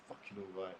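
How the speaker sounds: a low, muttered fucking all right; grudging, teeth clenched; through gritted teeth. Retold or imitated. Imitating the baby mum's greeting through gritted teeth. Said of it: "Fucking say it like that".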